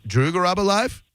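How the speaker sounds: Drew Garabo Live climbing to a high question; questioning tune. Shown as a demonstration. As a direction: lift LIVE right up, as if asking. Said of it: "I'm gonna say it another".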